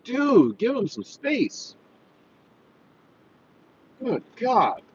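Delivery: high-pitched, swooping exasperation; real exasperation, pitch jumping up; exasperated. Real, not acted. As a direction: scold a tailgater in disbelief. Exasperated at a tailgating driver.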